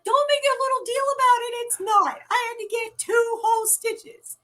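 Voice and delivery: high, mock-offended kid; imitating the teen defending his injury; indignant teen voice. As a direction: play the wounded teenager insisting. Teen's indignant reply retold.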